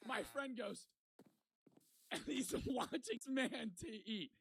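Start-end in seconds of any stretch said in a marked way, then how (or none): 2.1-4.3 high voice broken by real laughter; laughing through words. Real, not acted. Telling of watching his friend's meal, laughing through it. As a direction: giggle helplessly while telling it.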